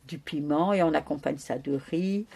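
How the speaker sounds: normal talking voice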